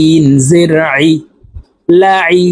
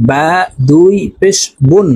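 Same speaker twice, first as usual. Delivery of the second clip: normal talking voice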